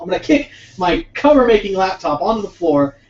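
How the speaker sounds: normal talking voice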